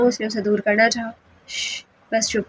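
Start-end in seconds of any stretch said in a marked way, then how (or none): 1.5-2.4 a soft shush, almost breathed; husband hushing his wife softly, close and quiet. Acted. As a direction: hush her quietly and firmly.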